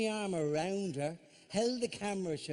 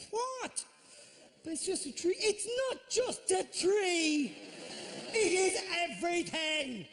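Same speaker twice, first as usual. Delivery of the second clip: squeaky, indignant shriek of a fussy craftsman; shrill offended tree lover; outraged high voice; 11 semitones higher than usual. Acted. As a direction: pitch it way up in wounded outrage. Joiner defends the tree.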